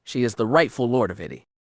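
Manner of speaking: ordinary delivery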